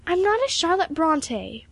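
put-on high, slow girlish protest. A narrator's reading. Pupil protesting her mentor's comparison, high and earnest. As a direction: protest earnestly, pitched well up. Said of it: "protested Emily".

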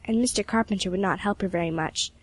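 ordinary delivery, nothing marked

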